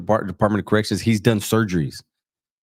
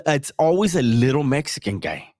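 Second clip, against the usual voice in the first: ordinary delivery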